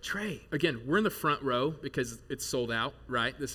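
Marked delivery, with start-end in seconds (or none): 0.0-0.5 whisper. Retold or imitated. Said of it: "because she whispers".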